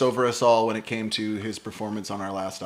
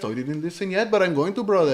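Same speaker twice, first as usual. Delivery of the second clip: put-on higher Colombian accent, placating; Gustavo; 4 semitones higher than usual. Bandmate excusing himself about the podcast, higher Colombian accent. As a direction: imitate a Colombian friend making excuses. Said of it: "my very best impression of him".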